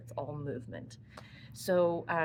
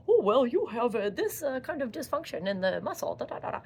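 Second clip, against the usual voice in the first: hammed-up diagnosis, higher and louder at first; mock talking shoulder; 5 semitones higher, 4 dB louder than usual. Shoulder answering back to its owner, mock expert voice. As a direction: pretend to be a body part rattling off jargon. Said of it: "it is not gonna come back to you and say".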